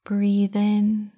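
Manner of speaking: guided meditation. Acted. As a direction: guide them into calm, unhurried. Leads a meditation.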